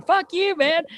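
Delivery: high, mock-grumpy player voice; Phil's voice. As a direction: squeak it like an annoyed buddy. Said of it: "I'm reading it how he talks".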